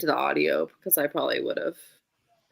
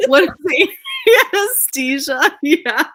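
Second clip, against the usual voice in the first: higher and louder, breaking into laughs; laughing; 11 semitones higher, 9 dB louder than usual. Real, not acted. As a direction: giggle through the word hunt. Laughing while fumbling for the word.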